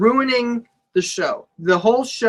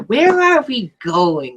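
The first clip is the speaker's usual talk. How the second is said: put-on clingy girlfriend voice; 3 semitones higher, 4 dB louder, much slower than usual. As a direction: plead like a needy teen girlfriend. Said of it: "I sound like a girl in a relationship".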